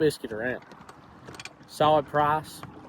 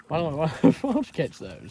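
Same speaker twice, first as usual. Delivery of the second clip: laughing; 4 semitones higher than usual. Real, not acted. Laughing while speaking.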